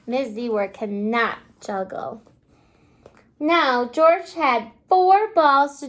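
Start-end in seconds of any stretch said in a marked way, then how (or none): none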